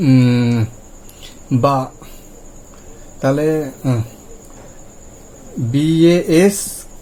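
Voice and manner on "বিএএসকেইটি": letters spelled out one by one, very slowly; slow spelling. Shown as a demonstration. Teacher slowly spelling basket letter by letter for learners. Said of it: "তাহলে দেখুন বয়া আকার উচ্চারণ হলো".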